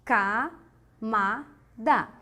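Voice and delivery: "Camada" very slow, in three separate beats; syllable by syllable. Shown as a demonstration. Teacher repeats the separation, slow spaced syllables. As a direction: pause after "Ca" and after "ma". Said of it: "Vamos separar".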